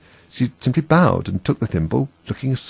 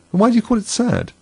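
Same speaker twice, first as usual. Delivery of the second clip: much higher wondering question; puzzled; 13 semitones higher than usual. A narrator's reading.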